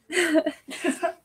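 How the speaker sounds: a high, breathy laugh through tears; embarrassed high laugh through tears; teary laugh. Real, not acted. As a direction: laugh it off while fighting back crying. Teary pause while crying.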